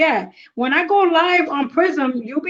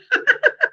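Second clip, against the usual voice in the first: laughter; 12 semitones higher than usual. Real, not acted. Laughing at first-time confusion.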